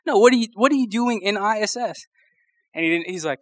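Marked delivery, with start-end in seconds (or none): 0.1-2.1 pitched-up, insistent question; high janitor voice. Retold or imitated. Janitor questioning a suspended student, high voice. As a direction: press him again, voice up high. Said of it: "he had such a high voice".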